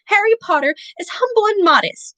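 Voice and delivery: high, hushed house-elf praise; reverent elf. A narrator's reading. Dobby praising Harry reverently, lightly hushed high voice. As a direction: speak with awe of a hero. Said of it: "said Dobby reverently".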